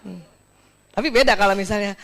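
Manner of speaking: ordinary delivery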